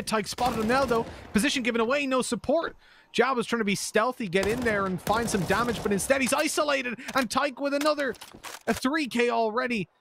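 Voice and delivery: high, fast-rising caster hype; excited play-by-play. Acted. Caster calls the multikill for viewers, voice climbing high. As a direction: climb with every kill.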